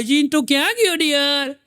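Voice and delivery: high mock call for her husband; wife calling. Wife calls her husband dear, high sweet call. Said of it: "પોતે બૂમ પાડે".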